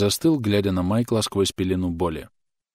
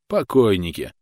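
smirking report; 4 dB louder than usual. A narrator's reading. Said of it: "доложил он с усмешкой".

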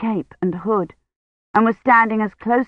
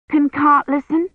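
put-on eager little girl; 8 semitones higher than usual. A narrator's reading. Eager child.

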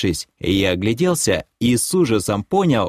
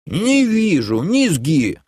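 старик упрямо отнекивается высоким голосом; отрежь высоко, не допуская возражений; отрезает; 7 semitones higher, slower than usual. A narrator's reading. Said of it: "отрезал старый лохар". (категорично, высоким стариковским голосом)

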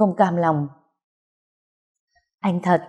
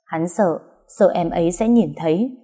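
ordinary delivery, nothing marked

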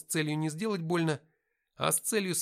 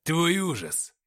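menacing answer; 5 semitones higher, 9 dB louder, much slower than usual. A narrator's reading. Possessed Nikita threatens.

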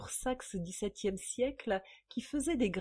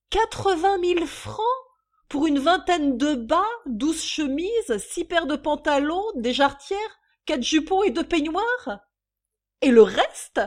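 high, loud quarrelsome itemizing; yelping shrew; 7 semitones higher, 10 dB louder than usual. A narrator's reading. Women quarrelling over the lingerie bill, yelping. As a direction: yelp it indignantly over her. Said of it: "glapit la mégère".

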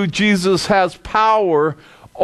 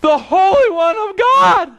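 shrieking in terror; 14 semitones higher, 4 dB louder than usual. Acted. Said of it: "he cried out".

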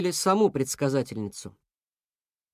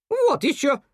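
high, louder snap; snapping cuckoo; 11 semitones higher, 4 dB louder than usual. A narrator's reading. Cuckoo snaps at moose, high and loud. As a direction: bark it back at him. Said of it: "рявкнула она".

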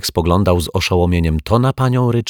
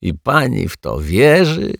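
louder, higher, exasperated groan; 7 semitones higher, 5 dB louder than usual. A narrator's reading. Caretaker groaning in disbelief at the neighbour. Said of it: "jęknął pan dozorca".

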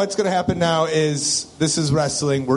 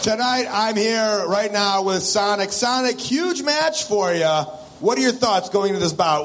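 booming ring announcer intro; wrestling promo; 7 semitones higher than usual. Pretend. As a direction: hype the crowd like an announcer. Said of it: "I'll be the intro guy".

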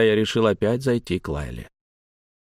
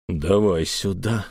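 low, slow, through gritted teeth; menacing strongman's low demand; grudging growl through teeth; 4 semitones lower, slower than usual. A narrator's reading. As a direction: squeeze the order out slowly and low. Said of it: "процедил Эльм".

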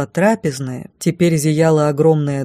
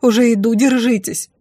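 raised, relieved call; urgent reassurance, higher-pitched; relieved exhale; 6 semitones higher than usual. A narrator's reading. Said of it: "облегчённо выдохнула я".

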